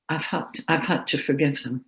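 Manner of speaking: nearly breaking, barely voiced; emotional. Real, not acted. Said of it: "I'm getting a bit emotional here".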